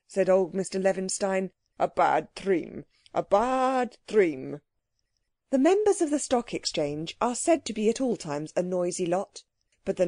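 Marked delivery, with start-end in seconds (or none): 1.8-4.6 put-on slow, drawn-out lament, foreign accent; accented old businessman. Old Levinstein laments his dream.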